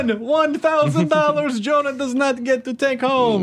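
very high, drawn-out counting voice; counting character impression. Mocking a friend's impression as a counting character, hammed-up voice. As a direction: count grandly in a slow, high accent. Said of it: "it almost sounded like".

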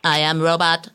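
stilted machine delivery; robot voice. Acted. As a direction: confirm it like a computer. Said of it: "get the normal voice talking mode back on".

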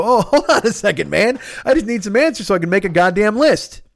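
high, laughing mock plea; retelling his laughing reply to a friend; laughing protest. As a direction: laugh while pleading to be heard. His own retold pleading reply.

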